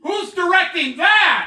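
mock shout. Mock-surprised joke about own show.

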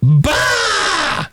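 high, exploding fake guffaw; mock morning zoo laugh. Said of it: "everybody else goes".

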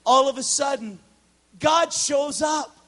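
loud, high, slow proclamation; preaching climax. Real, not acted. Preacher tells the congregation, loud slow proclamation. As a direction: proclaim it with full force.

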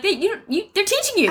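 high, stammered disbelief; retold high-pitched reasoning; exasperated parent. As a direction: sputter it at a nervous kid. Parent reassuring nervous child, exasperated.

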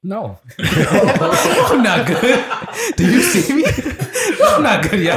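high, loud laughing through the pain; laughing hard, can barely talk. Real, not acted. Laughing while burning from spice.

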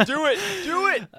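high, excited urging; playful egging on. Pretend. Co-host eggs on a friend about the voice, playful high urging.

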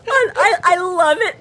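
stammering through real laughter; wheezing out the words between laughs; laughing. Real, not acted. Overcome with laughter.

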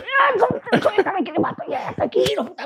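high strained yell; pretending to fight free, a mock shout; mock furious shout. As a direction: yell to be let go, hammed up. Acting himself restrained, shouting.

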